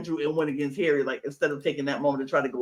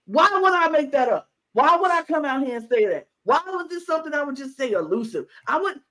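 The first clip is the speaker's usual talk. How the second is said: defensive; 9 semitones higher than usual. Retold or imitated. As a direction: plead your case, pitched high. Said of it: "He was like".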